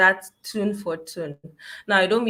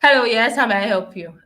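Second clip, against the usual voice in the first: loud, quick brisk greeting; brisk loud phone answer; 3 semitones higher, 7 dB louder than usual. Retold or imitated. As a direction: snap it out like a busy receptionist. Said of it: "And they're like".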